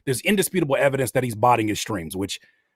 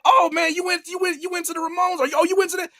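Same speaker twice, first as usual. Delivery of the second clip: much higher, eager mock-fan voice; high-pitched mock excitement about a band; fake fan gushing; 13 semitones higher than usual. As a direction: gush like a poser who never heard the band. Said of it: "And you'd be like".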